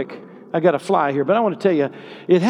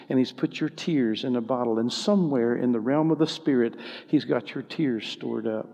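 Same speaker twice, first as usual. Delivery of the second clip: softer, lower, consoling preacher's voice; tender preaching; 3 semitones lower, 5 dB quieter than usual. Real, not acted. Preacher consoling those who have suffered griefs, tender preaching. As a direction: bring it down low and comfort them.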